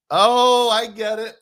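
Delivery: loud, high burst of getting the joke; sudden realisation. Real, not acted.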